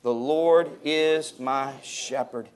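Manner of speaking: slow, weighty "Lord is my shepherd"; slow unison recital. Shown as a demonstration. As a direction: lead the congregation word by word. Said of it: "Say that with me".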